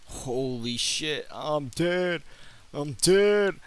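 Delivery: high, loud shocked wail; dismayed shout. Real, not acted. Dies in game, shouts.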